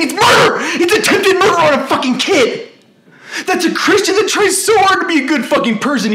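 high, loud, anguished rant; real overwrought yelling; distraught fury. Real, not acted. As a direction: cry out the injustice in outrage. Outraged account of attempt on life.

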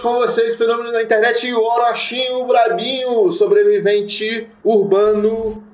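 high, booming announcer intro; grand entrance announcement. Pretend. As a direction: present him like a TV star. Mock show intro for episode. (anúncio de entrada triunfal)

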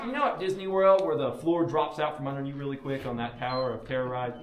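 ordinary talk

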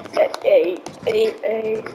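a hint of a low-pitched odd character. One-word character greeting a stranger, lightly put-on low voice. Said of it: "use your normal voice".

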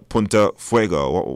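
ordinary talk

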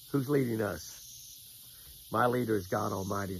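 usual speaking voice

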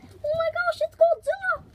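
high, loud, excited shriek; excited squeal. Real, not acted. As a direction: squeal with delight. Excited reveal of the result.

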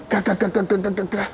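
soft quick ka ka ka of knuckles on glass; imitated knocking. Preacher imitates a robber knocking on his windscreen, soft rapid taps.

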